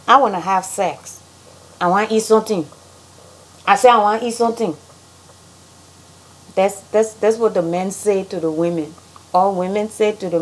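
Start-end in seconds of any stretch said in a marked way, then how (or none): 1.8-4.7 fast Liberian English; Liberian accent. Shown as a demonstration. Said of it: "how Liberians say things".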